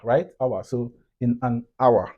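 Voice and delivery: usual speaking voice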